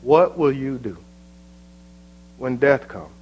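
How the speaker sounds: gentle plea. Acted. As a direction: plead quietly with the unbeliever. Soft altar-call plea.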